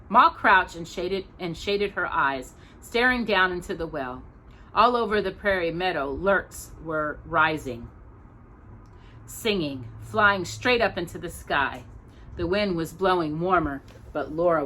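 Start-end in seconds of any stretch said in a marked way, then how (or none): none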